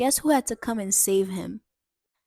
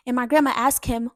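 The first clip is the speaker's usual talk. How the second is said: usual speaking voice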